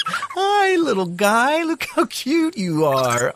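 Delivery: put-on high cooing baby talk. Host cooing at a baby raccoon in high baby talk. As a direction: coo at a cute little animal.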